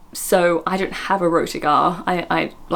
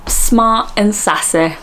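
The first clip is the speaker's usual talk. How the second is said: slow mock Essex; 3 semitones higher, 3 dB louder, much slower than usual. Host parroting the Essex clip, slow mock Essex. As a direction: mimic an Essex accent, drawn out. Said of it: "if I mirror someone".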